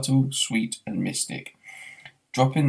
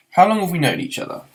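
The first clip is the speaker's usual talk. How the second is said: higher, louder, fast question; urgent; 8 semitones higher, 5 dB louder, faster than usual. Acted. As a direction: hurry the question along.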